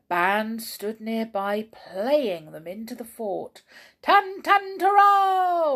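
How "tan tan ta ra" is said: high, loud trumpet sounds; imitated trumpet fanfare. Band playing soldiers in, trumpet fanfare. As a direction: toot it like a fanfare. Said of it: "went the trumpets".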